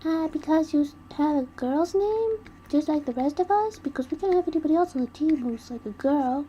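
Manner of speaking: high, loud and bouncy; chirpy Nagisa. Retold or imitated. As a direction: squeak it out like a cheeky kid. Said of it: "And Nagisa's like".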